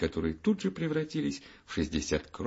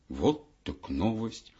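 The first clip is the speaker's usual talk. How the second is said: plain reading voice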